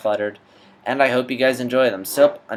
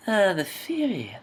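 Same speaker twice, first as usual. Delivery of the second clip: wrong voice try; 11 semitones higher than usual. Shown as a demonstration. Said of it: "No, wait, not that".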